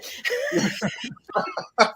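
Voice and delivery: high-pitched burst of laughter. Real, not acted. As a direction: let out a big high laugh. Laughs after her story.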